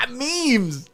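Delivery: high, loud fake cackle; mock laughter. Comic mocking hilarious Twitter jokes, exaggerated laughter. Mocks the meme joke's reception.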